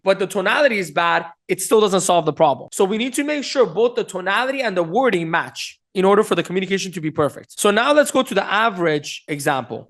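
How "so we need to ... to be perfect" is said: ordinary talk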